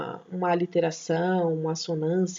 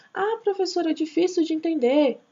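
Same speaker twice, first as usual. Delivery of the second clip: much higher complaining pupil; mock student whine; 7 semitones higher than usual. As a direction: whine it like a student who gave up. Teacher voicing a complaining student. (professora imitando aluno reclamando, voz bem mais aguda)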